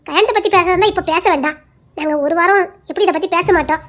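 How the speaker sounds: ordinary talk, nothing marked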